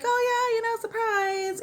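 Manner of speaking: mock-cheery surprise; breezy tech. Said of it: "she kinda was like".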